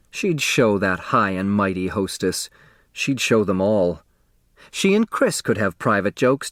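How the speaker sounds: ordinary talk, nothing marked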